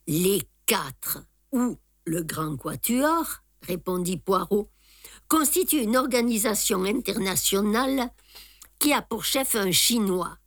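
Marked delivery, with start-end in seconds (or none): none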